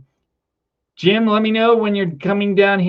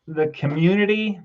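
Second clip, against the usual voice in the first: ordinary talk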